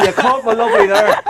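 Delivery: high delighted exclaim; thrilled it caught on; excited. Real, not acted. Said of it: "that's a first on the banter show".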